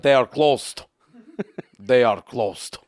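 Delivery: stern, exaggerated Russian accent; thick Russian accent. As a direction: lay on a heavy Russian accent. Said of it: "an accent that was so Russian".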